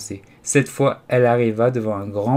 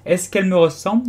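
high, eager question; keen, animated query; lively question; 8 semitones higher, 4 dB louder than usual. A narrator's reading. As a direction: ask it quickly and keenly, pitched up.

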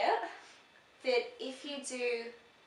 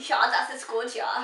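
put-on brisk German accent, a little louder; 5 dB louder than usual. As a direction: speak in a German voice.

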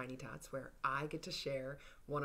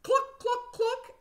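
high sharp clucking; chicken clucks; 5 dB louder than usual. Pretend. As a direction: cluck like a hen. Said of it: "And say".

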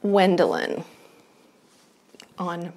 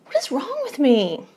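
normal talking voice

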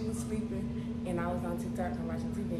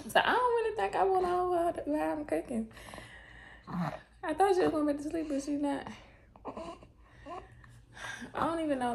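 high playful voice; 8 semitones higher than usual. Real, not acted. Keeps high voice holding baby.

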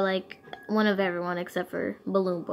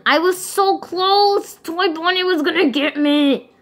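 whining; 7 semitones higher, 7 dB louder than usual. Real, not acted. Frustrated after failing.

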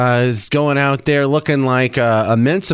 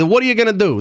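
higher-pitched mock New Jersey accent; 8 semitones higher, faster than usual. Host shows the accent he expected, mock New Jersey. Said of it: "that's the accent I thought".

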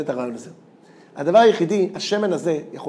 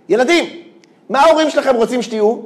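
put-on higher and louder, calling to the children; calling out to class; 6 semitones higher, 7 dB louder than usual. Speaker asks a class of first graders, calling out. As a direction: call out the question to a room of kids.

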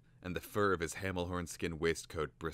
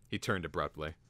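usual speaking voice

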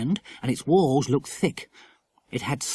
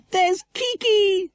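put-on very high, drawn-out child's cry; squealed high call of delight; excited shout; 4 dB louder, much slower than usual. A narrator's reading. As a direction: shout joyfully on spotting a friend. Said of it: "shouted Lucy Ann".

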